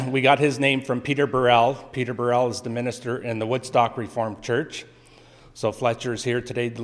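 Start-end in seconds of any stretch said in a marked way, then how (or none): none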